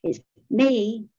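lightly smug phone announcement; a touch of mock self-satisfaction; pleased with myself. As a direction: announce yourself as if proud of it. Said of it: "pleased with myself".

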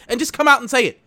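high, loud demand; angry demand. Retold or imitated. Demanding managers admit it, loud and high. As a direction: push them to admit it. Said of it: "And I'm just like".

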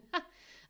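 a quick breathy scoffing laugh; short laugh. Acted. Caro laughing off the men's stubbornness. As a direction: laugh it off in one puff.

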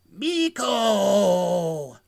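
long drawn-out mock call; Nuki. Nuki calls his lost brother psychically, hammed-up wail. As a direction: stretch the name out as an alien calling his brother. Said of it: "Nuki goes".

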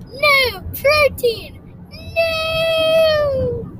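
high, loud wail of refusal; Rat's protesting shout. Acted. Rat as protein is thrown at him, high shout. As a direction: shout it high and loud, stretching the last word.

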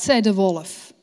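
ordinary delivery, nothing marked

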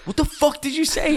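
a stranger's outraged demand; angry woman. Retold or imitated. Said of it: "and then he hears".

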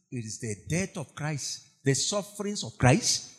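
usual speaking voice

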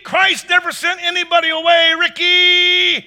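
yelling back to the preacher from a runaway bike; calling a name. Retold or imitated. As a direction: shout his name back across a rushing hill. Man calling back from speeding bike.

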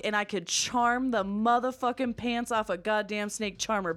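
usual speaking voice

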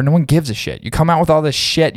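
ordinary talk

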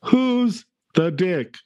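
"who's the dick" slow, high and loud, stretched out; mock pronunciation. Shown as a demonstration. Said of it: "let me tell you".

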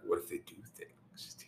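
hushed, teasing whisper; barely-there playful whisper; mock creepy whisper. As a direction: whisper the insinuation to needle your co-host. Repeats creepy tease, now whispered.

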